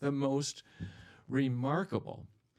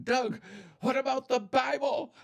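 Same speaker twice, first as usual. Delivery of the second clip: high, louder voice of a fretting listener; alarmed church member protesting; worried listener; 4 dB louder than usual. Pretend. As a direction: squeak it out like an anxious objector. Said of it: "some of you are just a little worried".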